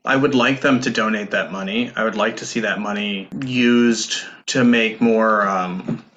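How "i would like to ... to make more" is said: ordinary talk, nothing marked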